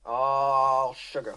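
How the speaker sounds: drawn-out, lazy repeat; slow drawl. Acted. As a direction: stretch every word of it.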